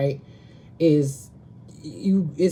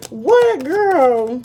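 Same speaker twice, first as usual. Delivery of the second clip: sharp and high, louder; 8 semitones higher, 6 dB louder than usual. Real, not acted. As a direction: challenge her, ready for a fight. Snapping at interruption.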